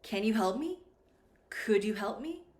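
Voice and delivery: yes-no questions lifting up on "me"; rising intonation. Shown as a demonstration. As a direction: send each "me" upward as a question. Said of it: "the intonation will go up".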